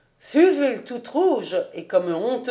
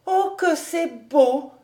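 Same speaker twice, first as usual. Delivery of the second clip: higher, slow, wondering sigh; admiring girl; 6 semitones higher than usual. A narrator's reading. Suzel marvelling at Fritz's waltz, higher and slow. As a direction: breathe it out in awe. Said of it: "dit-elle".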